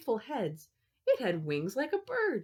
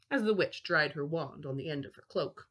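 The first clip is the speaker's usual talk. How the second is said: ordinary delivery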